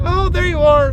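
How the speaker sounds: high, loud relieved call; imitated calling out. Old lady spotting the driver above, imitated high call.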